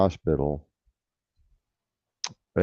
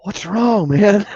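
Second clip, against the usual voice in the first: much higher and louder, worried call; alarmed shout; 7 dB louder, slower than usual. Retold or imitated. Teller on the phone asking his friend, alarmed shout. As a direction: shout back in sudden alarm.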